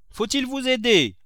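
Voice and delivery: high, loud call up the stairs. A narrator's reading. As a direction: shout the offer up to him. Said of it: "lui cria Nanon".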